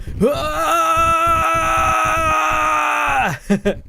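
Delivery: long, high sustained anime scream breaking into a laugh; hammed-up drawn-out anime scream; big anime yell. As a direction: hold one huge high-pitched hero yell. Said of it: "the big anime yells".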